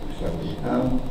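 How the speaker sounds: normal talking voice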